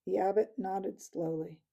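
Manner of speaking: ordinary delivery, nothing marked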